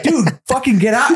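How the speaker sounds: high mock exasperated dude, get out; exasperated high yell. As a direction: pitch it way up in disbelief. Own exasperated reply retold.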